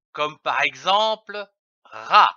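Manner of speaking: ordinary talk, nothing marked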